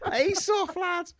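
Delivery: very high, drawn-out Scouse wizard; Gandalf. Acted. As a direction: taunt slowly in squeaky Scouse. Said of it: "Gandalf just saying to".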